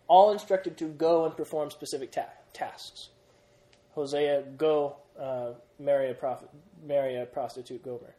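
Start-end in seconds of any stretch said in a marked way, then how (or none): none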